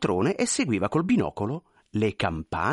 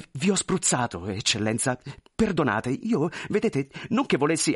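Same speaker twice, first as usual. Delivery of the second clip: much higher, pleading, flustered voice; anxious clerk; 3 semitones higher than usual. Acted. Clerk pleading with the general, high and flustered. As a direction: beg forgiveness nervously.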